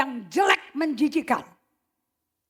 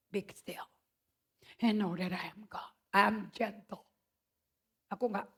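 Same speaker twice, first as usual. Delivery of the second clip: gentle God voice; 4 semitones lower, 7 dB quieter than usual. Acted. God's voice quoted.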